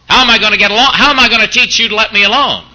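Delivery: mock outrage at turning the other cheek; indignant protest. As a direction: protest like a wronged man who can't hit back. Preacher voices indignant skeptic.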